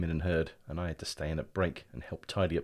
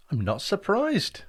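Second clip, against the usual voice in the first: motherly reply; 13 semitones higher, 6 dB louder, slower than usual. Acted. Mum replies to sick boy.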